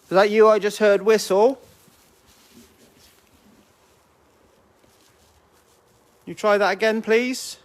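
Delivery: high, loud pleading call; talking to a ghost. Real, not acted. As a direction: coax the spirit, rising to please. Calling out to a spirit.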